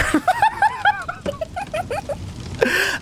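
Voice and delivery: long, high-pitched laugh; real drawn-out high giggling fit; laughter. Real, not acted. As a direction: laugh helplessly at your own story. Laughing at own fake-number trick.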